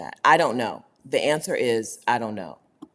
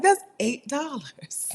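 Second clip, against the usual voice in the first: mock heartbreak; 9 semitones higher, slower than usual. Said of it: "I was like".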